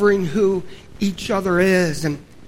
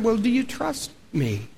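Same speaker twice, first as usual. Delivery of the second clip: soft, gentle question from above; hushed divine question; calm Lord; 8 dB quieter than usual. Acted. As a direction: ask it quietly, with calm authority. The calm Lord asks in return.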